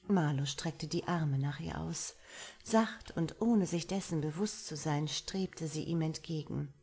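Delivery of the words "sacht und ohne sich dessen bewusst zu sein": usual speaking voice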